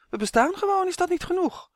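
high, puzzled little elf voice; a small creature baffled by the question; squeaky elf asking in honest wonder. Acted. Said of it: "vroeg Uf verbaasd".

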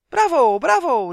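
very loud, high cheers; cheering. A narrator's reading. Little fairies applauding Justin's saying, loud cheer. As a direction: shout it out in delight. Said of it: "riepen de kleine veetjes".

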